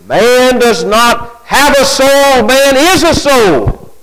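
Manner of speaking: slow, high, emphatic declaration; quoting the materialists. Retold or imitated. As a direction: state it slowly, like a fixed slogan. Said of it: "And they will say".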